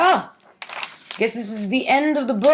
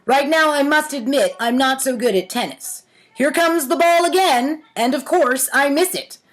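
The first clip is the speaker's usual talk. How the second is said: poem reading; 4 semitones higher than usual. Shown as a demonstration. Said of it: "The next poem is Tennis".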